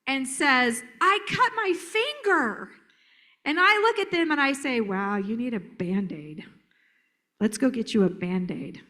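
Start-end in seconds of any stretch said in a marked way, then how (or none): 1.0-2.7 high, slow mock wail; hurt child. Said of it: "If a child comes up to me". 4.8-8.8 put-on respond evenly without matching the child's energy; calm. Shown as a demonstration. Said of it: "when I remained calm".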